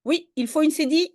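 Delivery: ordinary talk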